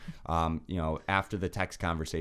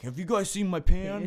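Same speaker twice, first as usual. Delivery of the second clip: higher, mock-innocent roommate voice; mimicking roommate; 11 semitones higher, 6 dB louder than usual. Roommate asking housemates about his missing pan, mock voice higher. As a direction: ham up the clueless roommate asking around.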